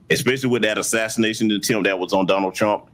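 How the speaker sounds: usual speaking voice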